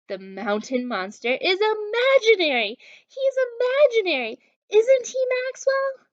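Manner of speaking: high, loud, panicky questions; frightened pleading. Acted. Scared friends pleading with Maxwell, high and panicky. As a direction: beg to be told it isn't real. Scared kids beg reassurance.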